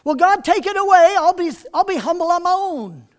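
high, loud pleading Paul; Paul pleading with God. Retold or imitated. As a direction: beg God to take it away. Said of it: "You know he would have said that".

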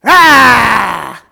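very loud, drawn-out mock roar; kids' monster yell. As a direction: roar like children playing monster. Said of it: "And the kids would say".

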